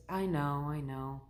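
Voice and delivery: low, soft, resigned admission; not so happy. Retold or imitated. Unhappy nurse gives in to patient's reassurance, downhearted. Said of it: "looked not so happy".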